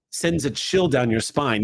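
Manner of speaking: ordinary delivery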